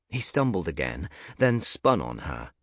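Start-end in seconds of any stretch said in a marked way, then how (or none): none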